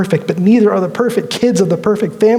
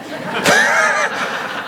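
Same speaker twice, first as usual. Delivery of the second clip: high burst of laughter; 4 dB quieter than usual. Real, not acted. [name]'s reaction to the boy.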